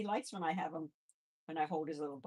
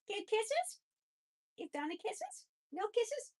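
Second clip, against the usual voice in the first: much higher, sing-song pet talk. Real, not acted. Coaxing her pet for kisses in high baby talk.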